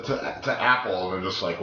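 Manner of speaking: ordinary talk, nothing marked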